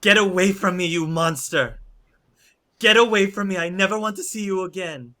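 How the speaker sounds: high, loud, furious rejection; horrified yelling. Acted. Woman to the werewolf she fears, yelling in horror.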